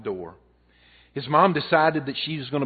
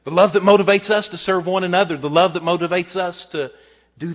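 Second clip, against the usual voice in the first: louder, higher preaching; preaching climax; 6 semitones higher, 11 dB louder than usual. Acted. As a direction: call it out to the congregation, full force. Sermon climax on love.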